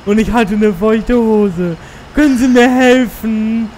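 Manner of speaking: put-on high, slow pleading teen; whiny teen voice. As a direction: beg like a clueless teenager. Reading a teen magazine letter.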